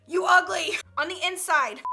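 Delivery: shouted insult. Acted. Roasting someone rated three out of ten, shouting. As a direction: blurt the insult at full voice.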